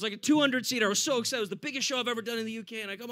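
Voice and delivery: normal talking voice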